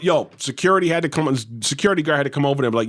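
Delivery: ordinary delivery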